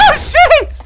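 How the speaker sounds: very high, loud shocked yelp; startled yelp. Real, not acted. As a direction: jump out of your skin. Sudden fright in game.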